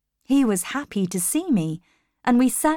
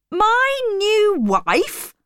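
high, loud, drawn-out incredulous question; shouted disbelief; 5 dB louder, much slower than usual. A narrator's reading. Rochester reacts to talk of his new wife, shouted disbelief. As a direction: stretch it out in outraged surprise. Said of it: "he shouted immediately".

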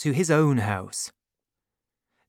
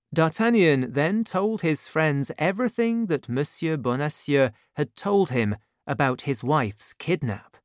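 plain reading voice

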